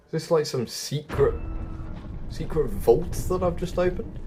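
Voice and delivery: ordinary talk, nothing marked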